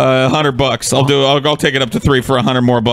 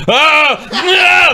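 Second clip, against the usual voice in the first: sharp yell of pain, much higher; pained yelp; 14 semitones higher, 3 dB louder than usual. Real, not acted. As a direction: cry out as the shock hits. Zapped by muscle stimulator.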